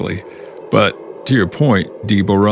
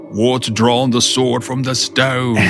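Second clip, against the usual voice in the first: slow, higher, drawn-out cartoon wizard; quoting an old Disney cartoon, slowly; cartoon character voice; 5 semitones higher, slower than usual. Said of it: "I love that cartoon".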